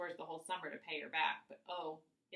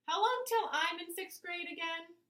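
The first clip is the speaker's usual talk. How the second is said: put-on high little boy voice; little boy asking; 12 semitones higher, 6 dB louder than usual. A narrator's reading. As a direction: ask like an impatient kid. Little brother asks impatiently.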